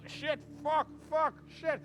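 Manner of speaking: cursing man falling. Retold or imitated. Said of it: "He falls back on the hay going".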